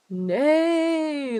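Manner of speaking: playful high, drawn-out neigh; horse neigh. Pretend. Streamer playing on the name Astro Horse, mock neigh.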